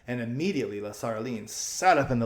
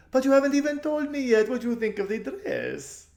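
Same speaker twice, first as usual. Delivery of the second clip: thrilled spoiled socialite; gushing society lady; 13 semitones higher, 4 dB louder than usual. A narrator's reading. Lasaraline voiced.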